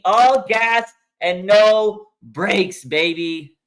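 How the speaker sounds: slow, loud stretched slogan; drawn out. Real, not acted. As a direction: stretch every word of the slogan. Slowed repeat of catchphrase.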